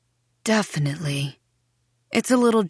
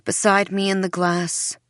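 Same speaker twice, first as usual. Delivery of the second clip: plain reading voice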